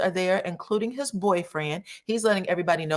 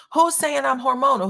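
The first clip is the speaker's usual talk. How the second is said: louder, higher mock outrage; indignant demand; 8 semitones higher, 4 dB louder than usual. [name] overhearing the family, a higher, louder indignant demand. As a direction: snap back at being called hormonal.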